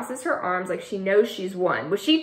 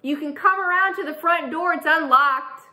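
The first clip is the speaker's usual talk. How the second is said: calling out; 7 semitones higher than usual. Acted. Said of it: "I yell up through the closed window".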